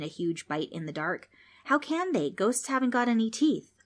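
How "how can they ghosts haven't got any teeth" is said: put-on much higher, skeptical girl; a girl poking holes in his story; lightly voiced doubtful kid; teasing girl. Trix teases ghost.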